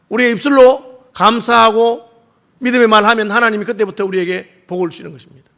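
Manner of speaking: ordinary talk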